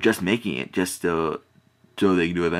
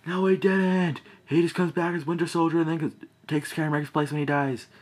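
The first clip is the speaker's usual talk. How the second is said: excited protest; 10 semitones higher than usual. Retold or imitated. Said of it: "I was like".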